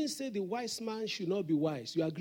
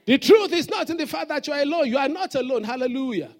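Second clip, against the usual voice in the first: louder, higher full-force preaching; 6 semitones higher, 12 dB louder than usual. Real, not acted. Preacher pressing the crowd for an amen, louder. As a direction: drive it home to them.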